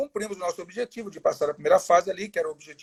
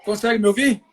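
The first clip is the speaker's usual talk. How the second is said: raised voice over a bad line; calling out, trying to be heard; to someone hard of hearing; 3 semitones higher, 6 dB louder than usual. Real, not acted. Call breaking up, can't hear.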